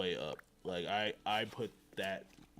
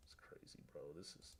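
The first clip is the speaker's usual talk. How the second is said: breathy hushed whisper, half to himself; whispered aside; 7 semitones lower, 21 dB quieter than usual. Real, not acted. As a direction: whisper it like you can't believe it.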